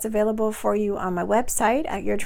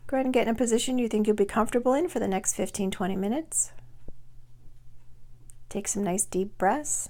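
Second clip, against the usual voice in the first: soft, unhurried meditation voice with long pauses; guided meditation; 9 dB quieter than usual. Acted. Coach guiding listeners to release depression, soft guided-meditation voice. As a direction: gently lead them through breathing and letting go, softer and measured.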